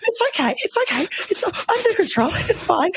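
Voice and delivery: high, bright-pitched fake brightness; forced cheerfulness. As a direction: sing it high to hide the panic. Pretending all is fine to in-laws.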